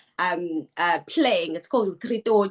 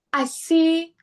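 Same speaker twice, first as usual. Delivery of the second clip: fighting back tears mid-sentence; choking up; 9 semitones higher, 4 dB louder than usual. Real, not acted. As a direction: voice suddenly jumping high as tears come. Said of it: "ooh, I'm gonna get emotional".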